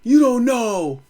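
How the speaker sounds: hammed-up know-it-all pundit; mock finance guy. Mocking a finance channel know-it-all, smug put-on voice.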